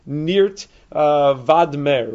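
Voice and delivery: North Woodmere misread as "Nirt, uh, Vadmer"; misread place name. Shown as a demonstration. Teacher showing how Hebrew letters get misread, mispronouncing "Nirt, uh, Vadmer". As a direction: say "Nirt, uh, Vadmer" slowly, with a V and an open A. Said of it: "pronounce it correctly and not read it as".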